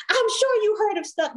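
high, pushy voice of the mom's coworker; mock insistent coworker. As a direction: insist high and sure of yourself.

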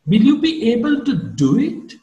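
"do" swings up high in doubt; doubtful question. Shown as a demonstration. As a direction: ask with suspicion, lifting "do it" high. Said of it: "I'm doubtful about your ability".

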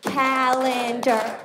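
CALENDAR drawn out in slow, separate syllables. Shown as a demonstration. Spelling it in a cubicle, "Calendar" slowly syllable by syllable. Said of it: "spell was by clapping out syllables".

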